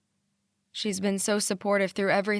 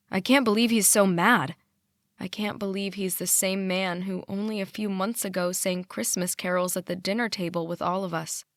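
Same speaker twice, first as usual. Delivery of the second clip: ordinary delivery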